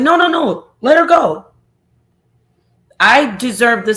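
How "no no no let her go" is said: high, louder husband's "let her go"; husband cutting in. Retold or imitated. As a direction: jump in for the husband, high and urgent.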